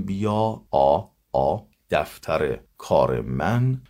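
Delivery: «بیا آ، آ، دفتر کار من» slow with stumbling «آ» fillers, vowels slurred; hesitant mumbled version. Shown as a demonstration. Teacher mimics sloppy hesitant speech for students. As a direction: drag out «دفتر کار من» sluggishly after stalling on «آ».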